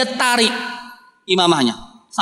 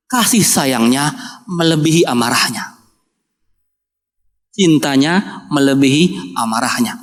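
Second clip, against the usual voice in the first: tender, slow; 8 semitones lower than usual. Acted. Pendeta menyebut ciri kenabian.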